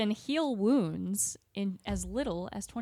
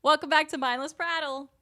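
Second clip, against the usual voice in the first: higher-pitched retake of the welcome; attempted no-accent intro; 6 semitones higher, 4 dB louder than usual. Shown as a demonstration. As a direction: lift the pitch for a simple show opener. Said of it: "don't do any accents or voices".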